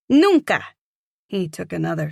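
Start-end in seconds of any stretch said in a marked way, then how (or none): 0.1-0.6 put-on high, loud child's Nunca with Spanish accent; cute Spanish accent. A narrator's reading. Boy refusing the elevator, high loud Nunca in a Spanish accent. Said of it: "cute little accent".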